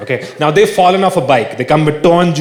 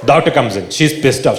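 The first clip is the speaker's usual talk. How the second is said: usual speaking voice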